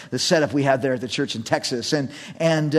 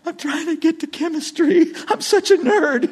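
high-pitched, mock-pathetic teenager; whiny pleading; 13 semitones higher than usual. Nerdy student pleading with cop, whiny and high. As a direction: whine your excuse in a high voice.

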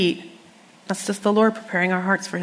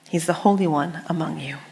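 ordinary delivery

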